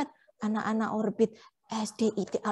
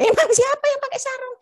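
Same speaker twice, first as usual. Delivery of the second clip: incredulous; 13 semitones higher, 6 dB louder than usual. Acted. Teacher doubts puppet's claim.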